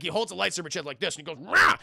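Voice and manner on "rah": high, loud burst; mock exertion roar. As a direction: roar as if heaving something huge. Imitating Dooku's Force exertion.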